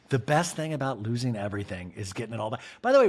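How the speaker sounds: ordinary talk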